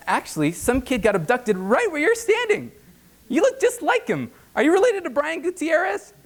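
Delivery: high, breezy ominous teller voice; mock creepy teller. Teller warning a stranded drunk, breezy and high. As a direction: deliver doom breezily.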